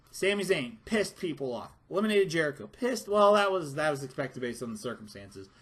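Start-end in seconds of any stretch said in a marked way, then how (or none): none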